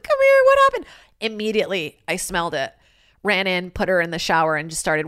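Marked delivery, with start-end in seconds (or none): 0.0-0.9 high loud frantic call; retold panicked shout calling the hurt dog. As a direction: call the dog over in alarm. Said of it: "I was like".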